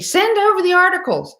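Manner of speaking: mimicking Dianne Feinstein's plea; imitating Feinstein. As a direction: pitch it way up to play her urging Nancy. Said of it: "even Dianne Feinstein is telling Nancy Pelosi".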